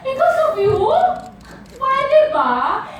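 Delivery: high, loud incredulous retort; indignant scoff. Acted. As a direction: scoff loudly at the very idea. Scoffs at boss's assumption.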